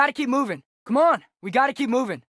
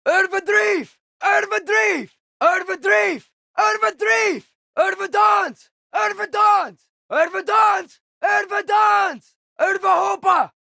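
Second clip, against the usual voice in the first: shouted incantation; 6 semitones higher, slower than usual. Acted. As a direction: call the spell out like a war cry.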